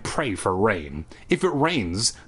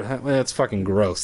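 plain reading voice

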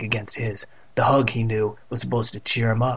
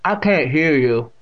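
slow, louder deaf great-aunt's voice; Catherine; 3 semitones higher, 5 dB louder, slower than usual. A narrator's reading. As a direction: speak distinctly as the deaf aunt. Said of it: "with that distinct pronunciation".

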